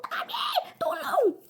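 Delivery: very high cry for help, laughing; shout for help. Retold or imitated. Calling her husband Tami for help, laughing shout. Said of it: "sigaw ako".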